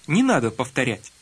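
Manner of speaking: ordinary talk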